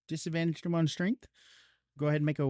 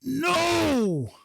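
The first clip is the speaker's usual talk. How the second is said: high, far-off anguished scream of the cursed book; a tortured scream in the reader's head; book's scream; 10 semitones higher, 3 dB louder than usual. Acted. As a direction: cry out as a pained, inhuman thing. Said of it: "it just screams".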